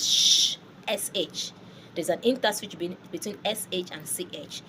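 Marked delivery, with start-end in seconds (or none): 0.0-0.6 sh as a sustained hush; French CH. Shown as a demonstration. Teacher demonstrating it like this, hushing French CH. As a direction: let sh run smoothly, no T. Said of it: "like this".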